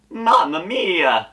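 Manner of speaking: high, slow Italian Mamma mia. Retold or imitated. Luigi borrowing Mario's catchphrase, high slow Italian voice. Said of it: "That's not my catchphrase".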